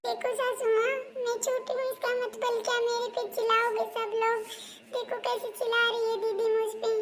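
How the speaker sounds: put-on high-pitched, put-upon young in-law; complaining daughter-in-law.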